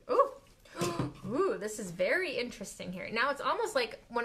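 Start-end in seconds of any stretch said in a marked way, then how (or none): 0.8-1.2 quick gasp of surprise; short amazed gasp; surprised gasp. Real, not acted. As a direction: gasp as the ink moves. Ink reacts unexpectedly.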